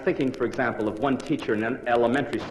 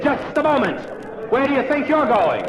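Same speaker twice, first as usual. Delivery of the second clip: much higher, louder scolding call; teacher's shout; 12 semitones higher, 3 dB louder than usual. Acted. Teacher calls out to the boy running off. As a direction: stop him in his tracks.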